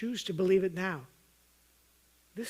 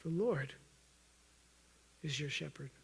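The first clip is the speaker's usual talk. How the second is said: hushed, lowered scripture; soft repetition; 5 semitones lower, 6 dB quieter than usual. Real, not acted. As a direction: lower and softer, almost hushed. Repeats the verse, each time softer.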